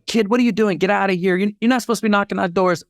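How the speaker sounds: high mock yell of annoyed adults; mock angry neighbors. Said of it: "people are screaming at me".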